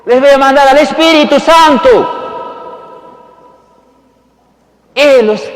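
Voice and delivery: very loud, high-pitched proclamation; booming acted announcement, pitched high; proclaiming shout. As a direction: shout the promise out to the crowd. Jesus's promise, proclaimed aloud.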